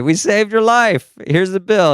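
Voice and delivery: high-pitched, gleeful hospital proclamation; mock triumphant announcement. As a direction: sing out the good news like a proud rescuer. Hospital staff announce rescue, then bill.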